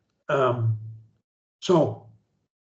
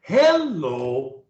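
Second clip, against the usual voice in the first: HELLO with a high first syllable, then dropping; start up high; 2 semitones lower than usual. Shown as a demonstration. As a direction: lift HEL up, let LO fall. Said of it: "you start up high".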